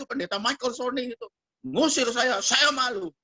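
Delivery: high-pitched plaintive outpouring; imitated upset girl. Girl telling how she blamed her boyfriend, imitated upset voice. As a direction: complain tearfully, as a mock re-enactment. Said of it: "nangis-nangis".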